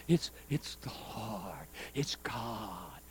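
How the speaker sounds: low, slow, almost whispered awe; hushed reverence. Retold or imitated. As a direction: drop to a reverent hush. Retells reverent answer to the man.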